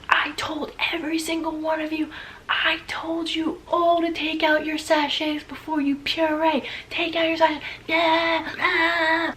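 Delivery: high, thin half-whispered scolding; mock screaming chef. Chef scolding the whole class, lightly voiced high scream.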